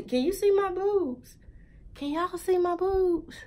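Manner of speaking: high-pitched, self-conscious question; embarrassed. Real, not acted. Vlogger suddenly worried about her shirt on camera, embarrassed. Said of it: "I'm embarrassed".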